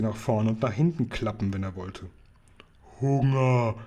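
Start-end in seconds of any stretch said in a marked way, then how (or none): 3.0-3.7 drawn-out giant's roar, held back in volume; a restrained, stretched giant bellow; brüllender Riese. A narrator's reading. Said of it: "brüllte der Riese". (gedehntes, verhaltenes Riesengebrüll)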